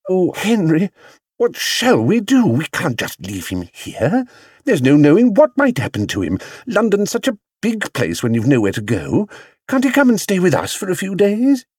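high, urgent wifely plea; appealing. A narrator's reading. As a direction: plead with swooping high emphasis. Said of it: "She turned appealingly to her husband".

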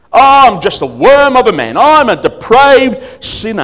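lightly mock a self-pitying churchgoer, slowing on depraved. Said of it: "That's the language he would use".

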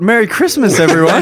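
loud, bright festive announcement; cheerful holiday greeting. Acted. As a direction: boom it out like a holiday host. Festive sign-off.

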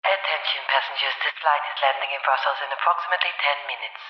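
cabin crew on the PA; landing announcement over the speakers; over the intercom. Acted.

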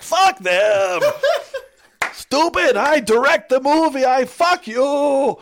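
angry grumpy-grandpa impression, shouted high; Fulci. Fulci cursing people who shush him, mock furious shout. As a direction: imitate an outraged director, loud, stretching the last words. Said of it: "everyone's favorite grumpy grandpa".

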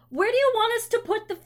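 high, fast exasperated retort. Acted. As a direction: fire it back, fed up. Retorting to complaining customer.

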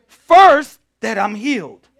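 loud, high preaching cry; preaching at full force. Acted. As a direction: hammer each phrase to the crowd.

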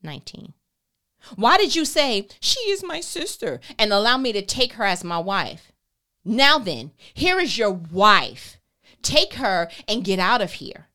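loud angry Pharaoh; loud angry Pharaoh reading; sharp accusation. A narrator's reading. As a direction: throw him out, loud and cutting. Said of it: "accused him sharply".

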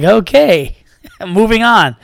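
mock polite exit, chuckling; laughing dismissal. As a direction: brush it off with a laugh. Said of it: "I was like".

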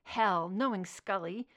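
ordinary delivery

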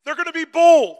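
high, very loud proclamation; fervent pulpit shout; full-force preaching. Real, not acted. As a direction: shout it out to the whole congregation.

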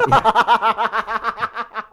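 high laughing yeah. Real, not acted. Agreeing with a friend while laughing. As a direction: squeal it through a laugh.

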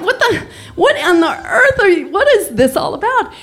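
high, laughing disbelief; mock outrage, laughing; incredulous. Wife asking husband about the gift, incredulous and laughing.

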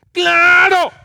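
sudden loud high exclamation; emphatic shout. Real, not acted. Pastor shouting agreement to the congregation, loud and high. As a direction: blurt it out at full voice.

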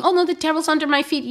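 high, fast mocking tone; mocking naive voice. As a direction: mimic a careless believer, high and quick. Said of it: "Christians talking about the devil".

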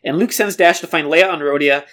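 ordinary talk, nothing marked

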